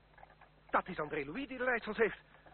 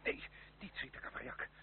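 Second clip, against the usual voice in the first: urgent hush; 8 semitones lower, 8 dB quieter than usual. Acted. Urgent plea not to shoot.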